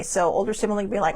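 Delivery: plain reading voice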